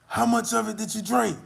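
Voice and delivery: high, loud imitation of shock; alarmed neighbor.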